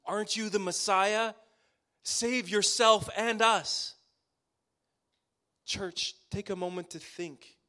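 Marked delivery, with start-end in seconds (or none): 0.0-4.0 loud, mocking taunt of a criminal; jeering insult. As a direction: hurl the insult up at him, slowing at the end. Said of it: "hurled insults at him".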